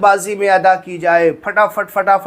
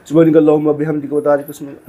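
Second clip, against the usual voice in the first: very fast, half-said words; rushed recitation with words swallowed; swallowing words; 3 semitones lower, much faster than usual. Shown as a demonstration. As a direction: eat the words the wrong way. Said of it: "नहीं। इस तरीके से नहीं कि आप लफ्ज़ को खा जाए".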